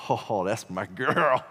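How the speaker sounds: slow, drawn-out fatherly pride; doting, stretched-out admiration; proud papa. Retold or imitated. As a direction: savor it like a beaming dad. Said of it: "I'm extremely proud papa".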